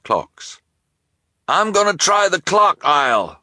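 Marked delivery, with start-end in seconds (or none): 1.5-3.4 high, loud call; crying out. Acted. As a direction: shout it to Lou. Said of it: "the shopkeeper cried".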